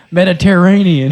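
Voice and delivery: slow, laughing drawled Mediterranean; West Virginia accent. Shown as a demonstration. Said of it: "That's my West Virginia accent coming out".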